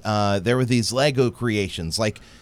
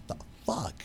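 hushed disbelief; whispered aside; 6 semitones higher, 15 dB quieter, faster than usual. Retold or imitated. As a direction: whisper it to the parent across. Aside to another parent.

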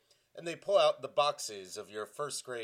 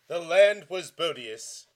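loud, high, slow declaiming; hammed-up verse recital; dramatic poetry reading; 6 semitones higher, 10 dB louder than usual. Shown as a demonstration. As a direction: boom it out as a grand poet. Reading the second terrible poem aloud.

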